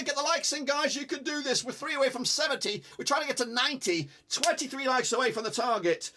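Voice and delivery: normal talking voice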